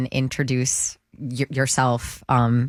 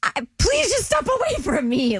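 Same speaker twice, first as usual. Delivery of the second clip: high, strained plea in retelling; mock desperate plea. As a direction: beg someone to back off, pitched way up. Retells her stressed inner plea.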